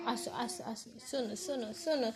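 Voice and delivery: soft calling. Real, not acted. Mother calling baby softly.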